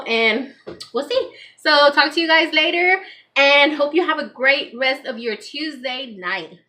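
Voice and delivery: bright upbeat sign-off to viewers; cheerful vlog outro. Acted. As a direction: wrap up the video cheerfully.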